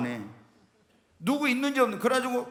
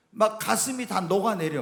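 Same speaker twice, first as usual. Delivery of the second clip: ordinary talk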